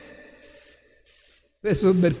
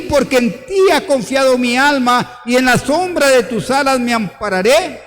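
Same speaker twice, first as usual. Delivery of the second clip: raised, higher voice, declaiming the psalm; loud psalm proclamation; 5 semitones higher, 6 dB louder than usual. Acted. Preacher proclaims the psalm to the congregation, loud and fervent. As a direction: call the verse out like a fervent prayer.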